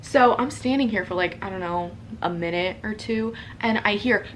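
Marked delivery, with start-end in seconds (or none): none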